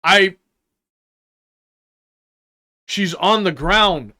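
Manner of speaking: ordinary talk, nothing marked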